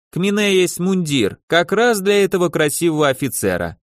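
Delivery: wheedling tailor with a Yiddish lilt; yiddish accent russian. Acted. Tailor Lazar haggling, Yiddish-flavoured. (лёгкий идишский акцент портного)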